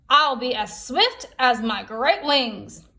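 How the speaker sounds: a proud buzzard stepping up; Buzzard's voice. Acted. Buzzard offers to fetch the fire, slow and proud. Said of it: "said Buzzard".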